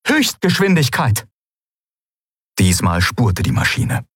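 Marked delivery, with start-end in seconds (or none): 0.1-1.3 high, firm order; commanding. Acted. Aylan ordering the ship to accelerate, firm. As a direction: give the ship a crisp command. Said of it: "befahl Aylan".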